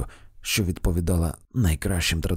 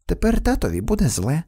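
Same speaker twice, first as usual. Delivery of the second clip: lightly voiced sly little girl; little girl slyly teasing her father; 7 semitones higher, 4 dB louder than usual. A narrator's reading. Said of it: "лукаво додала дівчинка".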